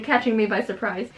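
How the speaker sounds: usual speaking voice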